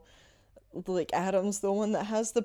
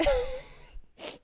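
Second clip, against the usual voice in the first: sudden high-pitched giggle; embarrassed little shriek of a laugh; high squeal laugh. Real, not acted. Catching herself in a lie.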